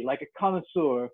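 ordinary delivery, nothing marked

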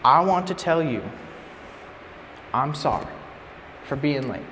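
low, soft, halting confession; heartfelt apology. Retold or imitated. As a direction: apologize quietly, pausing between phrases. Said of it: "first it was heartfelt".

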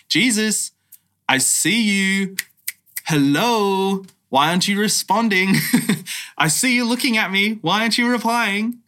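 mock-frustrated calling out to Jesus; exasperated prayer. Preacher voicing a frustrated believer, exasperated and pleading.

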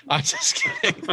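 laughing through the words. Real, not acted. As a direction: giggle while taking it back. Laughing after teasing about deferring.

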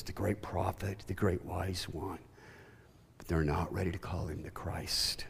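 soft unvoiced whisper; hushed aside. Real, not acted. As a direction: drop to a confiding whisper. Sermon point dropped to whisper.

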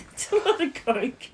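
loud, high, laughing words; can barely get it out for laughing. Real, not acted. Mum cracking up at husband's Coke haul, laughing loudly. Cracking up over dad's shopping.

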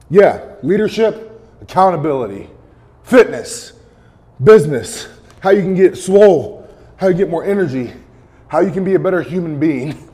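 loud, high, hyped list; pumped up. Real, not acted. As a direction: pump up the crowd. Pumped-up list during the plank.

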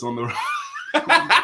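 words bursting into laughter; laughing at his own joke. Real, not acted. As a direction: crack up mid-sentence. Laughs while saying his joke.

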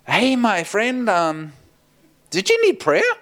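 high, tentative approach with a drawn-out greeting; gingerly offering. Retold or imitated. Preacher approaching a crying stranger to offer prayer, gingerly. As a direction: ease in, then lift the question very high.

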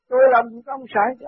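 mock indignant denial; retold indignation. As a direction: act out someone denying it crossly. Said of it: "ông đã sân lắm".

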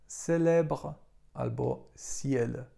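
teacher showing French C as S; French pronunciation. Shown as a demonstration. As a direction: say célèbre, then ciel slowly, the French way. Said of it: "na przykład w słowach".

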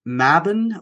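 "Mabon" as MAB-un; "Mabon" said MAB-un, second vowel reduced; near-miss pronunciation. Shown as a demonstration. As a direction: say "Mabon" with short a and weak ending. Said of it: "if you're saying it".

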